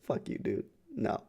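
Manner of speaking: whisper. Retold or imitated. Inner reply to hobo.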